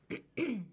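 dry throat clearing. Real, not acted. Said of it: "I have a frog in my throat".

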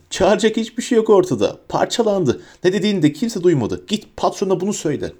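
high-pitched, defiant shouting. A narrator's reading. Harry taunts Bellatrix about telling her boss, shouting. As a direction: keep crying out high at the enemy. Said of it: "diye bağırdı".

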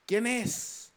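put-on a touch of an eerie little girl voice; lightly voiced little girl asking who; child asking. Child's eerie question in ghost story.

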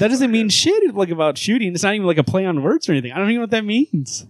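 high-pitched baffled rant; real exasperation. Real, not acted. Reader complaining the hostages line makes no sense, exasperated. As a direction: rant it high, at a total loss.

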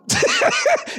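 a high burst of laughter. Real, not acted. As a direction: laugh out at the irony. Pastor laughs again.

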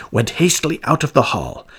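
plain reading voice

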